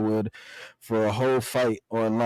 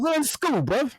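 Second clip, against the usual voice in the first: much higher, strained schoolkid voice; hammed-up high whine; mock panicked teen. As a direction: plead it like a scared kid in class. Playing Jimmy in the scene.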